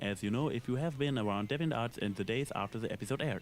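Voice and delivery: ordinary delivery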